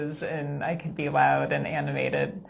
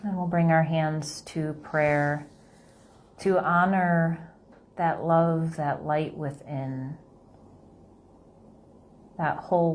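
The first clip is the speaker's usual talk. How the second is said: slow, soft meditation voice; guided meditation; 3 dB quieter than usual. Acted. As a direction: gently guide them through the breath.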